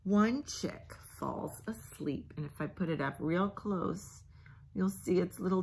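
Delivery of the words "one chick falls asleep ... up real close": soft whisper. Acted. Parent reading the sleepy line to the child, whispered. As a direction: hush as it drifts off. Chick falls asleep.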